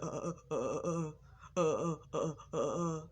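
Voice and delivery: rapid repeated stuck grunts, mimicking a stutter; mocking a stutter. Brother making fun of a sibling's stutter, mocking stammer. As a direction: imitate a brother's jeering stammer. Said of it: "my brother mocked me".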